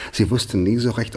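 ordinary talk, nothing marked

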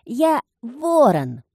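high, slow cawing shout; shouting child. Acted. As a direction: screech it like a crow. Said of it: "кричал он".

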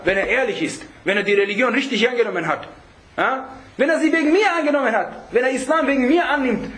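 normal talking voice

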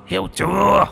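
high shouted demand. A narrator's reading. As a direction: yell the question at him. Ghost repeats threat, shouting.

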